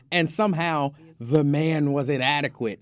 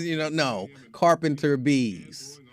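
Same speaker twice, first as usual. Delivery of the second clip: usual speaking voice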